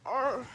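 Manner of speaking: loud exasperated groan; sudden loud cry of annoyance; frustrated "arrgh". Real, not acted. Own frustrated outburst about banned drinks.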